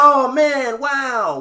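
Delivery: loud, high put-on dismay; mock sympathy. Attendant reacting to the driver's mistake, mock sympathy. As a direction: play up the amazed sympathy.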